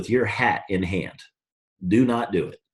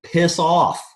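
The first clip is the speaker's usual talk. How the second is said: dismissive attitude; 6 semitones higher than usual. Retold or imitated. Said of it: "is the attitude I'd have on that".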